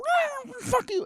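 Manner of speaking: a sassy cat hissing back; cat talking back. Retold or imitated. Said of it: "And they go".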